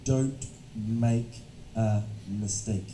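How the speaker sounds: dragged out word by word, sinking; slow, hesitant speech. Shown as a demonstration. Teacher imitating students afraid of mistakes, slow hesitant speech. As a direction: imitate an over-careful student, very slowly. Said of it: "Many students will speak slowly".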